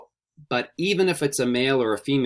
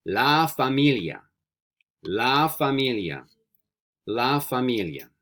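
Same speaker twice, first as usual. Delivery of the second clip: slow, drawn-out "La familia" for repetition; slow model pronunciation; 3 semitones higher, slower than usual. Shown as a demonstration. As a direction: model "La familia" slowly for learners to repeat. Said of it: "I'll say the word three times".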